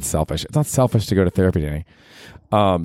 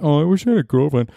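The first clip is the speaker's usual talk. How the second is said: higher, whiny wish; mock pining; 8 semitones higher than usual. Mocking his own wish for a girlfriend, whiny. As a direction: sigh it out like a lovesick sad sack.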